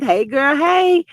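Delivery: normal talking voice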